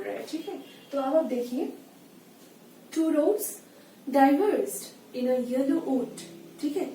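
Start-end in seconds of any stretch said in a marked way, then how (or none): 2.9-6.2 very slow, louder reading with "roads", "diverged" and "yellow" stressed; scanning stresses. Shown as a demonstration. Teacher showing students the meter, slow emphatic scansion. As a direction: read it out beat by beat for the class. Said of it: "roads पे आप stress दे रहे हो".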